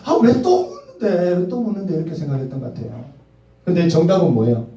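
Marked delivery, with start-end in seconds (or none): 0.0-2.0 irritated, higher pitched retort; mock annoyed, raised voice. Annoyed young husband snapping at wife's question. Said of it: "귀찮았어요".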